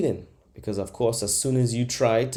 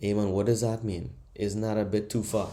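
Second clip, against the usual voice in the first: ordinary delivery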